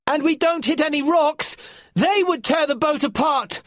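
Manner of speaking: high, loud warning yell; yelling back. Acted. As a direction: yell back at Lorca over the wind. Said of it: "Finn yelled back".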